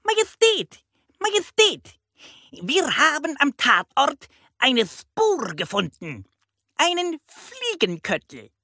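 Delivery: very high, croaky bird voice; piercing parrot squawk. A narrator's reading. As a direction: report excitedly like a parrot police chief. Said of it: "krächzte der Papagei".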